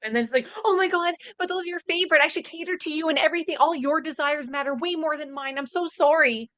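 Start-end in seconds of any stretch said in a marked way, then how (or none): none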